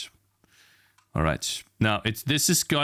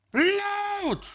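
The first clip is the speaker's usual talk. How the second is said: much higher, drawn-out strangled cry; squealed command. Real, not acted. Streamer squealing at the loading bar. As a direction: squeal at the screen to load. Yelling at software to load.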